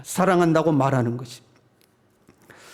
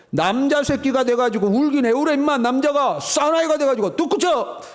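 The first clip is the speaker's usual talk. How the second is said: higher, rough mock scolding; scolding father; 8 semitones higher than usual. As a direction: bark at the boy to quit crying like a man. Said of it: "골라 입은 옷이 뭐예요".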